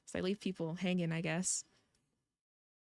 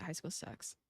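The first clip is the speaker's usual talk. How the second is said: a quick hushed aside; whispered aside; 4 semitones lower, 10 dB quieter, slower than usual. Real, not acted. A whispered aside to the viewers, half to herself. Muttered aside while reading.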